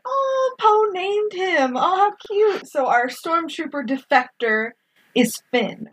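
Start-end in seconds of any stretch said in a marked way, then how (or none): 0.0-2.7 high, slow swooning gush; gushing over cuteness. Retold or imitated. Host reliving her delight at Poe naming Finn, high slow gush. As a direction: melt over the sweet moment. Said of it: "and I'm like".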